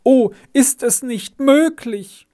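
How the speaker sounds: a father's pitch rising into anguish; beside himself. Acted. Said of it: "rief Herr Fehland fast außer sich".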